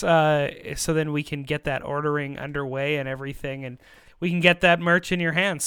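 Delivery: ordinary talk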